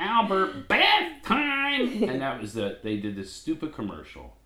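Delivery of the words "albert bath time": even higher loud call; hollering for bath time. Retold or imitated. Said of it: "See, I'm ribbing myself".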